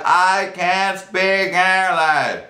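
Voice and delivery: slurred and very slow; tipsy can't-speak-English drawl; slow drunken reply. Retold or imitated. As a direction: drag out each word, barely coherent. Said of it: "I'm like".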